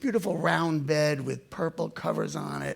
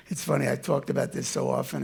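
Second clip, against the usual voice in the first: ordinary delivery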